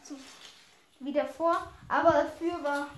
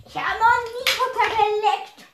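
loud, high, fed-up retort; irritated boast; 7 semitones higher, 7 dB louder than usual. Acted. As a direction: snap back, annoyed. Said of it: "brustete sich Karl entnervt".

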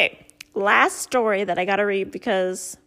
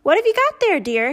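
mother asking; 7 semitones higher, 5 dB louder than usual. Acted. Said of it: "his mother asked".

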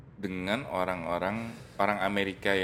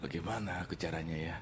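soft, hammed-up soap drama thought; soap opera inner voice; 3 semitones lower, 9 dB quieter than usual. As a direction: whisper it like a scheming soap character. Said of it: "Kayak sinetron kan".

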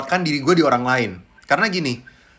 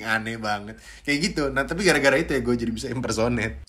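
usual speaking voice

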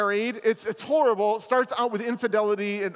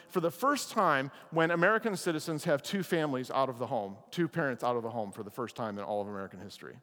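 ordinary talk, nothing marked

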